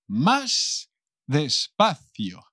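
"más despacio" stretched out very slowly; muy despacio. Shown as a demonstration. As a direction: draw out "más", pause, then "despacio". Demonstrates the word for slower. (despacio, alargando cada palabra)

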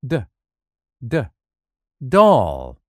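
the D sound spelled out, then doll; phonics drill. Shown as a demonstration. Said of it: "Repeat after me".

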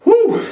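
loud, very high whoop; excited whoop. Real, not acted. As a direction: let out a hype yell. Hyping himself between push-up sets.

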